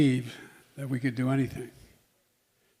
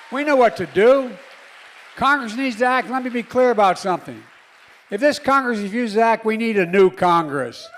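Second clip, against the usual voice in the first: higher and louder, driving call to action; rally speech at full force; 8 semitones higher, 10 dB louder than usual. Real, not acted. President urging young crowd that Congress must act, shouting. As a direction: rouse the crowd to demand action.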